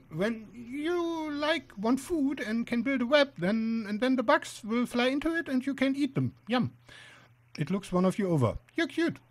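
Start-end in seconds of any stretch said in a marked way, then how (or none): 0.1-6.7 little spider explaining, voice pitched up; high-pitched inspection spider. Acted. 8.8-9.2 small, much higher spider voice; high-pitched spider. Acted. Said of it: "high-pitched voice".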